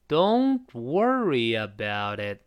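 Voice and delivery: "Don't worry about it" said slowly for learners; slow it right down, word by word; slow model read of "Don't worry about it". Shown as a demonstration. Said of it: "就再慢一点儿".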